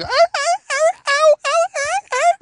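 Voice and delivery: very high imitated dog yelps; screaming dog. Dog spun around by the tail, imitated screaming. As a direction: yelp repeatedly like a hurt dog. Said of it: "screaming for all".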